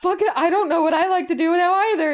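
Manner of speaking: high, loud, fast and half laughing; panicked blurting. Retold or imitated. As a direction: reenact a frantic freeze on the phone. Reenacting own panic on phone.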